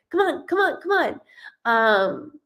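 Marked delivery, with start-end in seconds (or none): 0.1-1.2 much higher, coaxing come on; eager urging. Retold or imitated. Medium voicing the hyper friend urging everyone, eager. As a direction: pull them along playfully. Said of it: "she's down to do anything".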